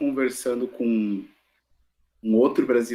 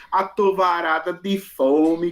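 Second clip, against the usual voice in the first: slow, drawn-out, badly faked Bahian accent; fake Bahian accent; 4 semitones higher, 3 dB louder, slower than usual. Teacher mocking Globo soap operas, phony Bahian accent. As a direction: parody a soap actor getting Bahian speech wrong. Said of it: "imitam um sotaque totalmente equivocado".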